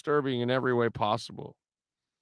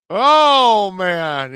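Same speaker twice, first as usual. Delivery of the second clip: high, loud drawn-out "oh", falling away; loud exclamation; 5 semitones higher, 9 dB louder than usual. Real, not acted. Host reacting to an outrageous caller, loud exclamation. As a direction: let out a big, high "oh". Exclaims after laughing at the clip.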